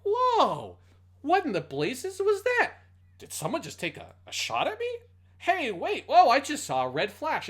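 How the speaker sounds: high, loud, mildly puzzled dub voice; underplayed reaction. Podcast host voicing Duke Jose getting shot at, high and puzzled. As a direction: sound casually confused while under fire. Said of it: "Duke Jose's reaction is like".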